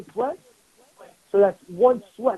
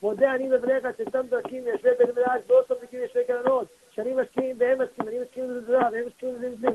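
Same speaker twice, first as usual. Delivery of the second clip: rapid prayer recitation; 2 semitones higher, much faster than usual. Shown as a demonstration. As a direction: rattle off the Hebrew prayer very fast. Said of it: "there's a special prayer ... says to say".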